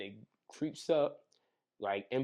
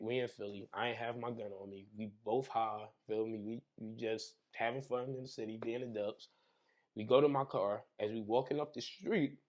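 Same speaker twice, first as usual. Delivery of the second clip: ordinary talk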